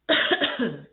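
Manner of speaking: sharp real cough; loud throat-clearing cough. Real, not acted. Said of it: "excuse me".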